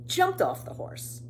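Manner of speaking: usual speaking voice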